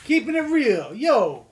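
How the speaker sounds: loud, high mock gangster bravado. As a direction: ham up a tough gangster.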